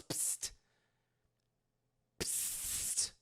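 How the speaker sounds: hissed psst to get attention; a drawn-out, secretive psst; quiet, sharp psst; whispered psst. Retold or imitated. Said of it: "and he goes".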